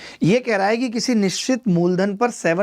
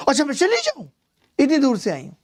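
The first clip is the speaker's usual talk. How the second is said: acted girlfriend protest; 7 semitones higher than usual. Acts girlfriend protesting at being sent away.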